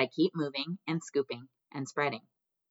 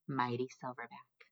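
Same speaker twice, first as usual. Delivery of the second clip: hushed, much softer narration; whispered; 9 dB quieter than usual. A narrator's reading. Gorilla turning toward his home, whispering softly. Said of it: "I whisper".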